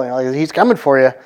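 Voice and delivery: normal talking voice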